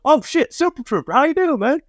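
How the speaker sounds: imitating a thrilled drunk fan; starstruck fan. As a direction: flip from anger to delighted recognition. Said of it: "and he goes".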